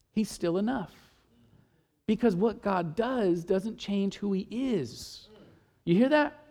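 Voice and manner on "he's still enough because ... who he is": sudden drop to a soft, slow close; hushed. Real, not acted. Preacher landing the point, hushed and slow. Quiet resolution after fervor.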